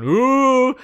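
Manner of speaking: loud, high rising "ooh"; exaggerated oops noise; mock-horrified "ooh". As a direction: swoop up high in mock alarm. Reacts to own name mix-up.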